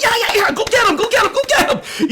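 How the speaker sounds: high, excited cheering at the screen. Retold or imitated. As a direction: urge the heroes on like a fan. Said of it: "I was screaming".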